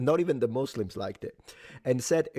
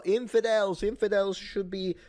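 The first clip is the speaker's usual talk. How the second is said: high, slow, hammed-up sermon cry, lightly voiced; mock preacher; 8 semitones higher than usual. Host mocking a sermon, high hammed-up preacher cry. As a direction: ham up a fiery preacher calling for heads. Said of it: "and they say".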